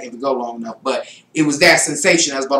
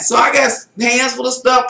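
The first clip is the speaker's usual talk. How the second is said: ordinary talk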